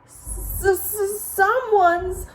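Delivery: high, slow tearful stutter; a frightened cub tripping over every word; stuttering Baby Bear. A narrator's reading. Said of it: "stuttered Baby Bear".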